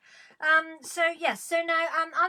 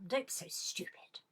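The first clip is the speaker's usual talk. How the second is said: exasperated aside; 9 dB quieter than usual. Real, not acted.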